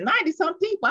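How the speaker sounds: high-pitched, incredulous question; astonished echo. Retold or imitated. Speaker retelling her startled reply to her sister, astonished. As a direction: throw the number back in disbelief.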